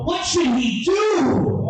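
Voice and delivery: high, slow shouted question; mock panicked stranger; stranger's voice.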